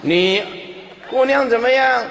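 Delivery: loud, high, comic questioning; demonstrated deathbed questioning. Shown as a demonstration. Helper quizzing the dying parent about a daughter, loud. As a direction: call out as if to someone fading. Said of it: "对死人要这样讲的".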